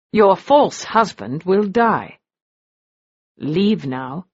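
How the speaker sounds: cold, even command; icy. A narrator's reading. Man orders his wife away before the duel, ice cold. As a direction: ice in every word. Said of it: "His voice was like ice".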